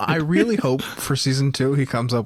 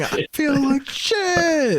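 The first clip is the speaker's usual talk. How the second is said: hammed-up strained high wail; mock screaming; much slower than usual. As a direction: screech it slowly like a bad rocker impression. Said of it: "just by someone screaming".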